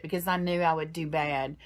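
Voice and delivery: usual speaking voice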